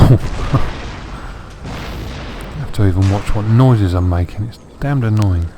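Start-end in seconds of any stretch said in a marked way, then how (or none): 0.1-2.5 long breathy chuckle; laugh. Real, not acted. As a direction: laugh it out without voicing. Laughs about his sore throat.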